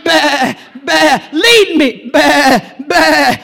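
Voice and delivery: lightly voiced bleating sheep. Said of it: "the sheep are saying".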